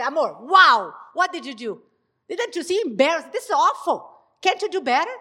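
shrill blaming rant at her husband; harsh scolding tone. Shown as a demonstration. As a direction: show the wrong way, scolding sharply. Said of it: "is the tone that you use".

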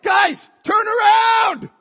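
high, slow, full-voiced yell to the group; acted-out alarm call, stretched out loud; urgent shout. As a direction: yell a warning across open water. Friend yells urgent warning while swimming.

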